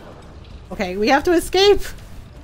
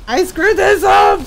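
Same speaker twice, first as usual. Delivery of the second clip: panicked yell, higher; panicked shout; 7 semitones higher, 7 dB louder than usual. Real, not acted. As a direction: shout it in frustration. Panicking in the game.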